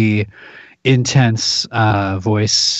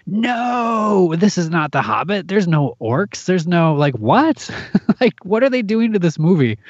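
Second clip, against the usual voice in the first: incredulous; 6 semitones higher than usual. Retold or imitated. Retelling outrage at Hobbit film.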